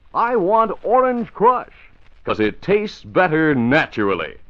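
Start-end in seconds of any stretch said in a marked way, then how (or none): 0.1-1.9 Orange Crush stretched slow and high; chipper 1950s ad. Shown as a demonstration. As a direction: sing out "I want Orange Crush" slowly, high and bright. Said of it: "Don't just say orange. Say".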